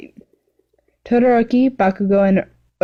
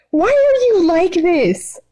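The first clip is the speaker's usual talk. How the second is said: exasperated yell; 11 semitones higher, slower than usual. Acted. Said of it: "Mimikis yelled out".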